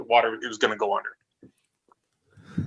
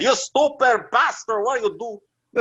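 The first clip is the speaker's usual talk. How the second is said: put-on high, slow, shouted Italian accent; yelling in a thick Italian accent; 10 semitones higher, slower than usual. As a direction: scream it like an angry Italian. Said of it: "he's got, like, a thick Italian accent".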